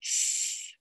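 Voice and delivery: a long unvoiced sss for S; hissed S sound. Shown as a demonstration. Teacher models the S sound to the child, hissed. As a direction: hold the S as a hiss. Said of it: "Do it with me".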